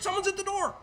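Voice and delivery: high, jumpy cry of alarm; hammed-up startled yelp about the doorbell. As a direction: shoot up in pitch like the bell just jolted you. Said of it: "you're like".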